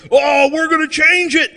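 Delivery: mock earnest activist. Comic mocks friends vowing change, in earnest mock pledge. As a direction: parody a fired-up friend. Mocking friends' outrage again.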